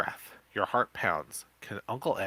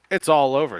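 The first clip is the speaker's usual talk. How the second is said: much louder, higher triumphant call; 6 semitones higher, 12 dB louder than usual. Acted. Uncle Ed calls out triumphantly to the cemetery man, loud. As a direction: call it out in victory. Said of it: "Uncle Ed calls".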